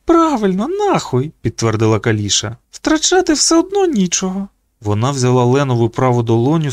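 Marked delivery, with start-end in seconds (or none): 0.1-1.3 put-on very high, girlish character voice; girl's agreement. A narrator's reading. Girl backing up her friend's swearing, high-voiced agreement. Kalisha backs the boy up. 2.7-4.5 put-on very high girl's voice; girl's agreement. A narrator's reading. Girl saying there is nothing to lose, high-voiced agreement. Kalisha backs the boy up.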